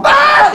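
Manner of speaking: very high, loud shriek; startled scream. Acted. Athena finding the girl behind her, startled shriek. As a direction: scream mid-sentence in shock. Athena turns and sees body.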